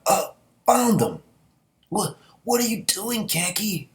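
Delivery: hammed-up, high and slow; old man voice. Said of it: "he comes out".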